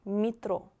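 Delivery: Метро with unstressed е said as и, mi-TRO; metro read right. Shown as a demonstration. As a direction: say Метро as mitro, weak first vowel. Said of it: "Because E is not stressed".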